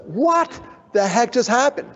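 high, drawn-out disbelief; bewildered shock. Acted. Speaker realizing the mass email went out, high bewildered shock. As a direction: stretch it slowly, rising in bewilderment. Clean retake of shocked reaction.

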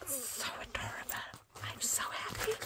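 voiceless, happy hush; delighted whisper. Real, not acted. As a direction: whisper it, overcome with joy. Overcome by cute pouch.

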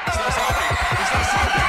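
panicked scream. Real, not acted. Chased by monster, screaming.

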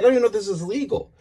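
high, rushed mock protest; retold bickering. Husband objecting to wife at auction, retold bickering. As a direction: imitate a husband protesting in a rush.

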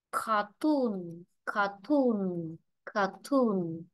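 "cartoon" with a long, falling second syllable; teacher drilling "cartoon" as car-TOON; stress on TOON. Shown as a demonstration. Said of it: "trọng âm rơi vào nó".

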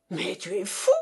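mildly growled disbelief; growled protest. A narrator's reading. Russian to partner who refuses the share, growling. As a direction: grumble in restrained outrage. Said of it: "gronda Baratov".

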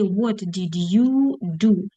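slow question with YOU stretched; measured teacher modelling; drawn-out prompt. Shown as a demonstration. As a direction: linger on "you" before "do". Prompt before the full question.

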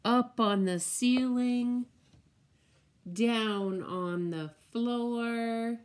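slow, sing-song picture book read; reading to children. Acted. Teacher reading the spider book to children, slow and sing-song. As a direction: savour each spider word for little ones.

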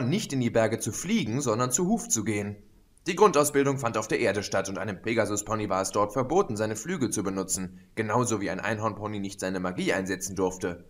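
ordinary delivery

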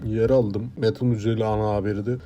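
low, soft, resigned has-been; eski şöhret, bezgin. Acted. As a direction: murmur it low, as someone long past their fame. Plays washed-up former TV news figure. (bezgin, alçak sesli eski şöhret)

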